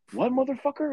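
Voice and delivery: high, indignant mock outrage; retold angry outburst. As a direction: play the offended boss snapping back. Boss confronting prank victim.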